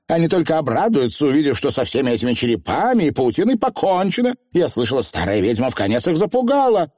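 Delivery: put-on high, confident young witch; breezily superior witch; haughty young witch. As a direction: chatter self-assuredly in a high voice. Voices Anagramma, confident.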